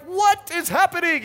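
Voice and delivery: high, loud baffled cry; bewildered. Retold or imitated. Husband at her Walgreens request, loud and bewildered. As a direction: throw up your hands in disbelief. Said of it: "I'm like".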